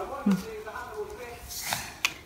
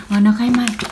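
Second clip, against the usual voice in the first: ordinary talk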